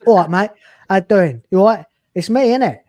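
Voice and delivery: cheeky Cockney lad brushing himself off; Cockney bloke. Pretend. Cockney Doctor greets his assistant after regenerating. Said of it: "some Cockney bloke".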